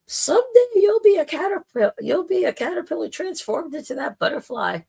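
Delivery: squeaky high-pitched telling voice; high playful voice; high mock voice. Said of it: "if you told some of the caterpillars".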